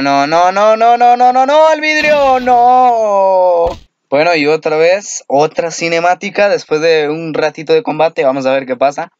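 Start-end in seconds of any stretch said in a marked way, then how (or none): 0.0-3.7 panicked no's climbing higher and louder; rising panic. Real, not acted. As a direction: build from groan to panicked yell. Panicking as game goes wrong.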